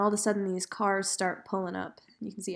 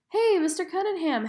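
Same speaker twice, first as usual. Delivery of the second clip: much higher, louder repeat of "Hey, Mister Cunningham"; louder call; 10 semitones higher, 6 dB louder than usual. Retold or imitated. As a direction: call him again, insisting. Scout calls again louder.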